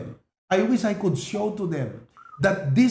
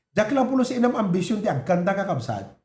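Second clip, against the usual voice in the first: ordinary delivery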